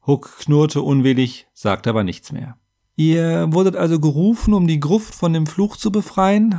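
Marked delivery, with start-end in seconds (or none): 3.0-6.6 higher, questioning nudge; helpful prompting. A narrator's reading. Scribe helps the warrior back into his tale, prompting. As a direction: nudge the storyteller along. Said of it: "half ich Dalaga auf die Sprünge".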